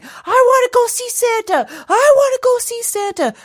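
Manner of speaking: put-on very high, loud childish wail; screaming child tantrum. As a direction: re-enact a kid's screaming fit. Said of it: "started screaming and crying".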